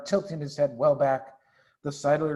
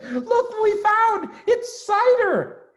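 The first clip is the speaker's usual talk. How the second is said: high, loud and slow with delight; thrilled child showing off a treasure; excited cry; 6 dB louder, slower than usual. Acted. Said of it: "he cried out".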